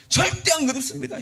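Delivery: 높이 치솟은 목소리로 펄쩍 뛰며 부인하는 베드로; 말도 안 된다는 듯 크게 항변해; 강하게 부인함. Acted. Peter protests to Jesus. (예수님께 절대 아니라고 맞서는 베드로, 높고 큰 항변)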